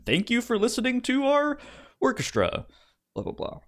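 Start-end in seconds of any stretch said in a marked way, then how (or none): none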